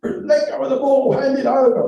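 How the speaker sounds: high, loud barked order from the touchline; rugby master's shout. Retold or imitated. Master ordering the boy under the scrum, high loud shout. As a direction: mimic the master yelling at the pile-up. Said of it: "the master would say".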